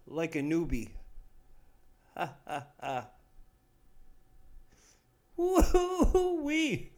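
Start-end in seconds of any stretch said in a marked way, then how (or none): 5.4-6.9 very high drawn-out woo-wee; excited whoop. Real, not acted. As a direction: cry it out in delight. Exclaiming after a hit.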